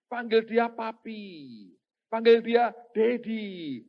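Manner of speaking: loud, high, drawn-out exhortation; mock-enthusiastic teacher, words falling away slowly; effusive urging. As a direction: stretch the pet names out high and slow. Acting out a teacher's enthusiastic advice.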